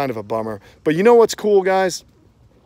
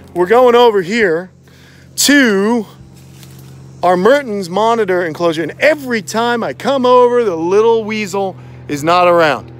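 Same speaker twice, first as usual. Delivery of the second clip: louder, projected voice for the phone mic; talking loud; 6 semitones higher, 9 dB louder than usual. Real, not acted. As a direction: push the voice out so the phone picks it up. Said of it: "I'm gonna talk loud".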